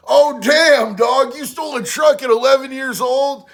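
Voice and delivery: loud, high disbelieving "oh damn"; amazed at the story; surprised. Real, not acted. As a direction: react with real shock. Reacting to stealing a truck.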